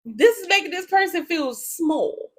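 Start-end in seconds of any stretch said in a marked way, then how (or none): 1.5-2.3 "small" drawn out slowly; distinctive "small". Real, not acted. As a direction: stretch the word "small" for emphasis. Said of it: "Why every time you say small, you say it like that".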